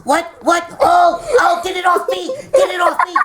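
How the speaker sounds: high, loud panicked cry; panicked shriek. Real, not acted. [name] shrieking at the scorpion on her shoulder. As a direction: shriek to get it off you.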